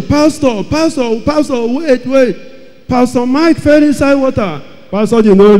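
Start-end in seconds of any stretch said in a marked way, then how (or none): 0.0-2.4 mock shouting. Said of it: "People were shouting".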